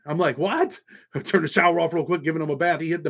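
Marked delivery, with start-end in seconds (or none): 0.4-0.8 high, disbelieving what; sudden high-pitched what; startled what. Retold or imitated. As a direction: react as a stunned dad. Shocked by son's words.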